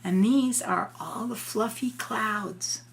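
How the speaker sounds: ordinary delivery, nothing marked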